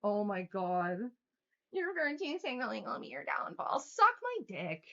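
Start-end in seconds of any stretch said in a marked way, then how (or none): 1.7-3.8 higher sing-song mockery of "Your virtue signaling will be your downfall"; mocking parody. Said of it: "mocking repeat of the comment". 3.8-4.8 defiant retort. Real, not acted. Angry retort to viewer.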